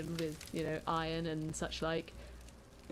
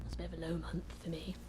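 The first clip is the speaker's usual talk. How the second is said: whispered aside; 2 semitones lower, 4 dB quieter, much faster than usual. Real, not acted. Muttered aside about his month.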